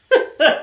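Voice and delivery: short loud high giggle; quick loud laugh. Real, not acted. Laughing again at the yawn.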